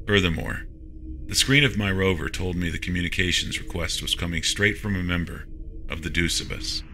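ordinary delivery

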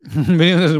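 usual speaking voice

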